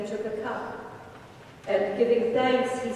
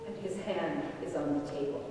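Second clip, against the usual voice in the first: softer, grave and low-key; hushed solemn warning; 3 semitones lower, 7 dB quieter than usual. Acted. Jesus tells the apostles his betrayer sits with them, hushed and grave. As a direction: let it sink to a hush as you name the betrayer.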